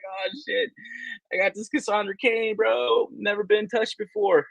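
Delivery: high, joking seller voice; mock seller's pitch.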